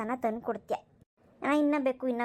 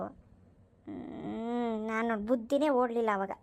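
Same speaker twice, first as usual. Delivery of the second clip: ordinary talk, nothing marked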